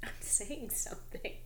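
slow soft whisper to yourself; whispered resolve. Retold or imitated. Shopper deciding to speak up, slow whisper. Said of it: "and then I was just like".

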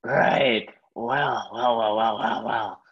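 low sinister drawl; menacing villain. Pretend. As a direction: gloat like a villain. Assassin's menacing reply.

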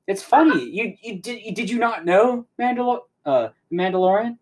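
lightly imitated gloating villain. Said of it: "just starts cracking up. He goes".

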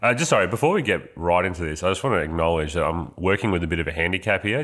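usual speaking voice

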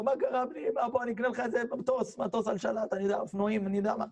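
high, fussing, soothing offers; acted frantic father. Father consoling hurt son with gifts, high and fussing. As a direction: fuss over the child in a high worried voice.

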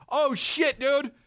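high surprised gasp; high fake surprise; mock shock. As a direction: pitch up in fake alarm. Acting shocked passenger.

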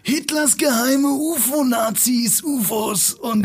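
sehr hoch, sehr langsam und dramatisch; übertriebener Doku-Erzähler mit viel Pathos; Doku-Sprecherstimme. Retold or imitated. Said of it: "diese Dokus gesprochen hat". (parodiert die Stimme der Hitler-Dokus auf N24)

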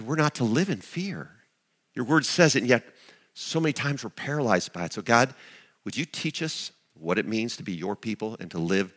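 soft, low prayer voice. Acted. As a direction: pray quietly and earnestly. Leads a prayer.

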